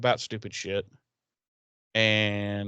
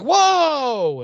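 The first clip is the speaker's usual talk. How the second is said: loud high whoa; 8 dB louder than usual. Retold or imitated. Buffy startled in retold scene.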